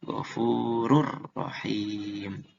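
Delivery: "ghafurur rahim" very slow, N merged into a rolled R; tajwid demo. Shown as a demonstration. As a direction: draw out "ghafurur rahim" with a doubled R. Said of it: "Tapi ini jadi".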